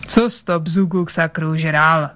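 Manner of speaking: plain reading voice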